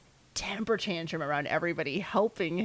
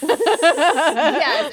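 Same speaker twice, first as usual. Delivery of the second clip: loud, high laughing yes; 8 semitones higher, 11 dB louder than usual. Real, not acted. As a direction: burst out laughing as you agree.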